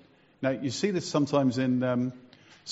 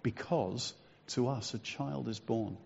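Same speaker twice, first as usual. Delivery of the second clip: put-on softer, slow, reverent "Because to us a child is born"; soft reading; 7 dB quieter, slower than usual. Shown as a demonstration. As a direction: read it quietly, letting it land. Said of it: "And then you get to this verse".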